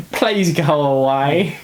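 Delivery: slow, put-on Sokovian accent with a laugh in the middle. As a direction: show off a playful Sokovian accent, slowly. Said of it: "That's my Sokovian accent".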